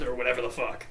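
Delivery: normal talking voice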